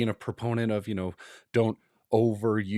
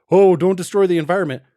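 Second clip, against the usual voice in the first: louder, higher, hurried mock plea; sanctimonious scolding, put on in mockery; exaggerated eco-warning, pushed out loud and quick; mock preaching; 5 semitones higher, 8 dB louder, faster than usual. Mimics preachy environmental virtue signaler.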